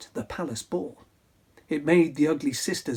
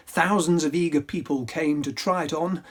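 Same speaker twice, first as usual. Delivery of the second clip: plain reading voice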